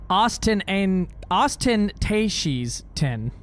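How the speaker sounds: Austin Tashies ten said slowly, sounding it out wrong; slowly mangle it as Austin Tashies ten; fumbling pronunciation. Real, not acted. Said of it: "how you pronounce this word".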